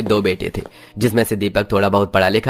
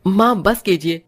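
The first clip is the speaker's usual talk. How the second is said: put-on higher young man's voice, firm and defiant; indignant son; 10 semitones higher than usual. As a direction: stand up to your mother for your bride. Narrator voices son Jitendra.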